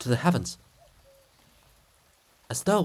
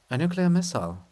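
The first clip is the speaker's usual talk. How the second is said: ordinary talk, nothing marked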